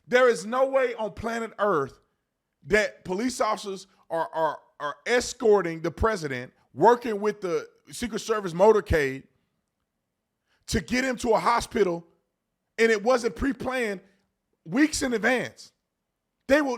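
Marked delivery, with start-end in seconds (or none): none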